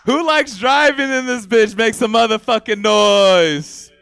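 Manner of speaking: loud crowd hype shout. Real, not acted. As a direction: pump up the crowd. Hyping crowd.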